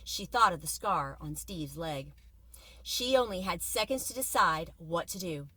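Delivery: ordinary delivery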